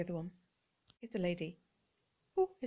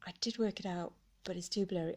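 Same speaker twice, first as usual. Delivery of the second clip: normal talking voice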